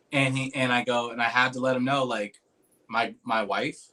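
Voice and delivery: normal talking voice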